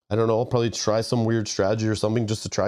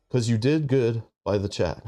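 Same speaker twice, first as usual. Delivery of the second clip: ordinary talk, nothing marked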